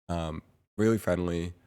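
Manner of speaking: ordinary delivery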